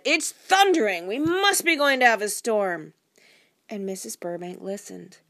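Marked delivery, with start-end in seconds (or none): 0.0-2.9 loud, high-pitched cry of alarm; shouting. A narrator's reading. Husband warns wife of a storm, shouting. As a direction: call it out over imagined thunder. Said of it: "Immediately he shouted".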